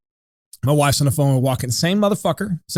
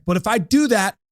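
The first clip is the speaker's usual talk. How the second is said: whiny manager; 6 semitones higher than usual. Retold or imitated. Sal mocks the manager's excuse.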